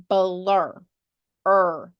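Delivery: "blur" drawn out, then its ending "er" alone; enunciated final R. Shown as a demonstration. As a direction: sound out "blur", leaning on the final "er". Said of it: "Hear how at the end of that word we really enunciate".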